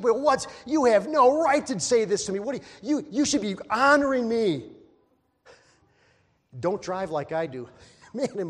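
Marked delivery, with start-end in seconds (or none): 0.0-4.6 high, loud indignant whine; mock offended outrage. Preacher mocking proud people who feel slighted, indignant. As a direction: play the wounded ego, loud and high.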